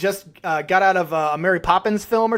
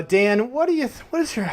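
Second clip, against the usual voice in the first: usual speaking voice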